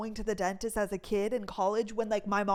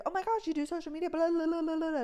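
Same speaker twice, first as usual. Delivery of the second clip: high, fast, bubbly imitation of excited staff; gushing staff impression; 6 semitones higher, faster than usual. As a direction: gush it like an overexcited fan. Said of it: "So everybody's like".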